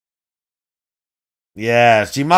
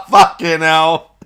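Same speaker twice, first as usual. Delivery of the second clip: laughing hard; 5 semitones higher than usual. Real, not acted. Cracking up at the clip.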